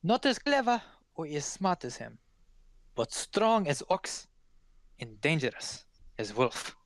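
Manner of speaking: wise foreigner sizing up a dangerous man; cautioning Vistani woman. Acted. Vistani woman warns of the brother, cautioning in accent.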